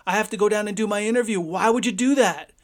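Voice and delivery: put-on young broadcaster yelling at his mentor who tore up his questions, furious; flipped out. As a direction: yell at the mentor in disbelief. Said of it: "I flipped out".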